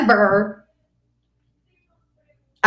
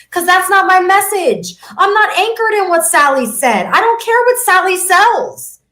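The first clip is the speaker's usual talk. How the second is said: fired-up rant; 8 semitones higher, 3 dB louder than usual. Real, not acted. Worked-up rant about Sally.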